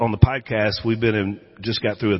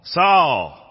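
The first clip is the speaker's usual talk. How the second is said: higher and louder booming call from heaven; commanding voice of God; 5 semitones higher, 5 dB louder than usual. Retold or imitated. Heavenly voice calls Saul on the road, louder and higher. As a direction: call his name again, rising and louder.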